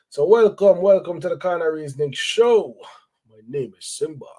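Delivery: big, bright radio host intro; radio announcer. Shown as a demonstration. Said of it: "I sound like a radio announcer".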